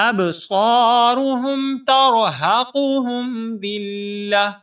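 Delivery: slow, melodic tajweed chant; Quran recitation. Shown as a demonstration. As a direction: recite it drawn out like a qari. Recites verse. (تجوید کے ساتھ تلاوت)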